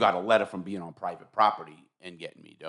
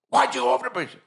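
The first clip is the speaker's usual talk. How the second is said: imitating the cop; 5 dB louder than usual. Cop questioning him, retold.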